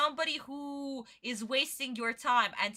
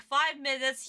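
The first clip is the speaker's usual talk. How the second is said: ordinary talk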